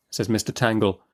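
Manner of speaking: ordinary delivery, nothing marked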